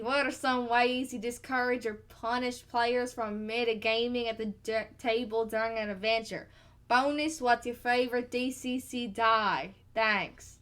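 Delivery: slow put-on Kentucky drawl; Kentucky accent. Co-host reading a listener's question as requested, Kentucky accent. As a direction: read it out in an amateur Kentucky accent. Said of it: "this time in a Kentucky accent".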